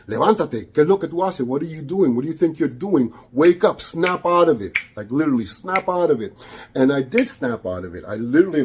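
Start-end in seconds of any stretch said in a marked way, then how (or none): 0.1-4.8 higher, insistent wake-up call; urgent spirit of light. Retold or imitated. Spirit shaking [name] awake, higher and insistent. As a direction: snap him out of it. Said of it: "It was, like, shaking me".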